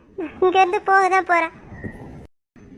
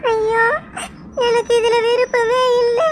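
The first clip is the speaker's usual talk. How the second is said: crying; 3 semitones higher than usual. Acted. Girl lamenting unwanted engagement.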